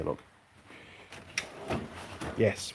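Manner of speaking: normal talking voice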